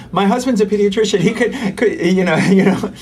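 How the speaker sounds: imitating wife boasting to passengers, laughing; laughing brag. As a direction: tease like a proud wife. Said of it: "she's going up to everybody".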